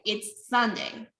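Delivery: Sunday slow and stressed. Shown as a demonstration. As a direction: lean on Sunday as the new information. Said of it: "the Sunday is the new informations".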